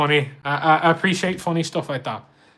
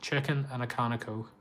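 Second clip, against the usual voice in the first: Scouse accent; 7 dB quieter than usual. Shown as a demonstration. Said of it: "Did the Scouse thing".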